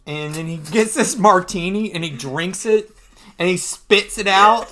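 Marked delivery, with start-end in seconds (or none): none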